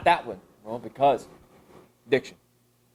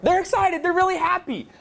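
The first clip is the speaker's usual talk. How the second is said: much higher, louder happy voice; bubbly, acting it out; gleeful higher voice; excited; 3 dB louder than usual. Teacher acting out happy kids.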